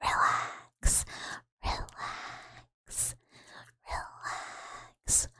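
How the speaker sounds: soft, breathy whisper; ASMR whisper. Shown as a demonstration. ASMR streamer whispering relax to viewers. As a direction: whisper it to soothe. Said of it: "people just like hearing stuff like".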